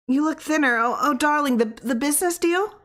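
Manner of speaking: high, fluttering wife; wife's voice. Acted. Worried wife greets her sick husband, high and fluttery. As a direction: fuss over him anxiously.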